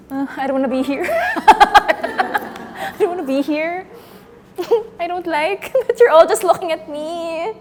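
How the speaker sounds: real panicky giggles breaking up the words; nervous laughing. Real, not acted. Performer all eyes are on, nervous high laughter. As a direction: laugh helplessly while wanting out, pitch climbing.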